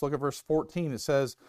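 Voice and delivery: ordinary delivery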